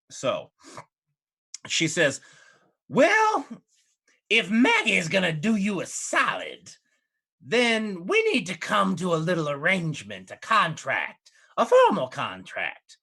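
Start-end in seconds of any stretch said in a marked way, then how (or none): 2.9-13.0 scheming hag. Acted. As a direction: lay out the terms with relish. GM voices Maggie proposing contract.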